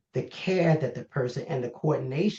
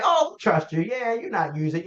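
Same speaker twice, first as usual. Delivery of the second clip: high, exaggerated reassurance; hammed-up, higher-pitched credulous tone; mock trusting; 7 semitones higher than usual. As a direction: play at believing them completely. Acts out believing a client.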